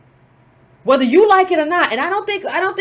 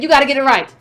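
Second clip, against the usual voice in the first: usual speaking voice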